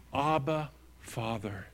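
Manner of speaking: soft, falling away to very quiet; hushed prayer. Acted. Preacher voicing Jesus praying to his Father, hushed. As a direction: pray it softly, letting the last word sink.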